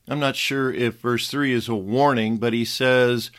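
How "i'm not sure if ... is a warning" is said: ordinary talk, nothing marked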